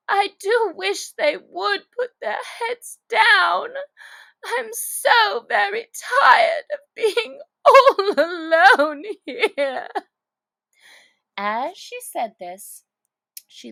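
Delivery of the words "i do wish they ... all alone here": higher, louder, slow tearful voice; sobbing. Acted. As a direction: sob it out, lonely. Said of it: "cried Alice with a sudden burst of tears".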